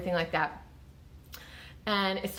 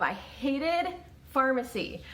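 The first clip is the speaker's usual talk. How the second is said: usual speaking voice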